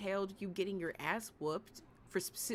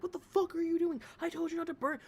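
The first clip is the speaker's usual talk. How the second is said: mock angry parent; 8 semitones higher, 4 dB louder than usual. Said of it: "smack you across the head and be like".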